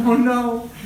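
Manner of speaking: high, hammed-up worry; mock dismay. Teacher faking dismay for the children, high. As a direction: pretend to be shocked.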